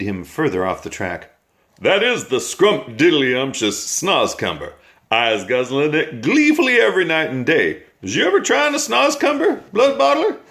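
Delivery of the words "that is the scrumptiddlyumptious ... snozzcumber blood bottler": high, bouncy voice of a kindly giant; cheerful giant, pitched up and eager; friendly giant. A narrator's reading. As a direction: coax the brute sweetly, climbing higher. BFG voiced, giant's sing-song.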